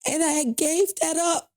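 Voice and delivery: holding back crying while telling it; near tears. Real, not acted. Telling the host about the lost interview, close to crying. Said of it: "forgive me for getting so emotional".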